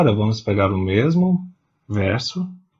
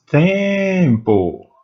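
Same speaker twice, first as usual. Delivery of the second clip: "Tempo" with the first syllable TEM drawn out and raised high; stress on TEM; 6 semitones higher, 4 dB louder than usual. Shown as a demonstration. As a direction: lean hard on TEM in "Tempo". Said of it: "Qual é a sílaba tônica?". (ênfase em TEM de "Tempo", prolongada e aguda)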